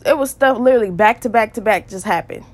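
ordinary delivery

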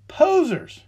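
Posers thrown out high and loud; scornful shout. Real, not acted. Angry rant at the band.

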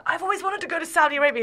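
high, fast and loud, bursting with eagerness; eager gush. Retold or imitated. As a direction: blurt it out, thrilled at the chance. Eager reply to new crush.

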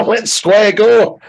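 slow Glasgow challenge to fight; Glasgow street accent. Pretend. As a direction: drawl out the square go like a Glasgow hard man. Scottish hard man bit.

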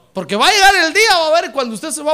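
high, loud warning cry; preacher's sudden loud warning; preaching shout. Real, not acted. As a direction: ring it out over the church. Sermon peak.

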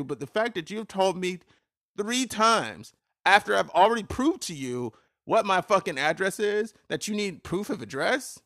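real anger at the state's paperwork; heated rant. Real, not acted. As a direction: ramp up into an outraged rant. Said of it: "That's a little bit of a heated".

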